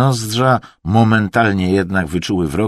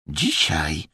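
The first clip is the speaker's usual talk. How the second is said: soft, otherworldly echo; haunted, hushed pronouncement; possessed; 3 semitones lower, 5 dB quieter than usual. A narrator's reading. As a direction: utter it quietly like a seer. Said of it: "głosem nie swoim, nawiedzonym".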